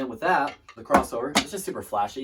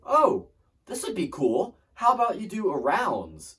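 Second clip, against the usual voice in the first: higher, slow, eager imitation of the band director; imitating band director; 9 semitones higher, 5 dB louder than usual. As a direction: pitch the idea like an excited teacher. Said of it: "and he was like".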